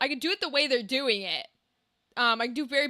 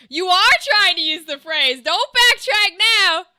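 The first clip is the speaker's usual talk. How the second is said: loud; 4 semitones higher, 8 dB louder than usual. Real, not acted. Heated argument, insisting.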